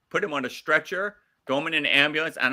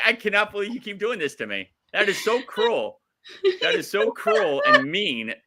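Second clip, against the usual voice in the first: mock outrage; 7 semitones higher than usual. Real, not acted. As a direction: wail about how cruel it is. Complaining about the noise.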